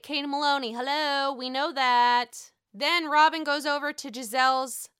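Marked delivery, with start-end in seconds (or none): none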